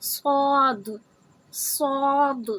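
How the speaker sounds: drawn-out, mouth-filling "صاد" with a bouncing d at the end; heavy Saad. Shown as a demonstration. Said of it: "صوت کو موٹا کر کے پڑھیں گے". ("صاد" موٹا، منہ بھر کے، آخر میں دال کی پلٹتی آواز)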